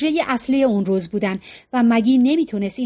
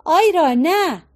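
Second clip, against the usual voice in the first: shouting; 6 semitones higher, slower than usual. A narrator's reading. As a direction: cry out to stop him. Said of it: "مگی فریاد زد".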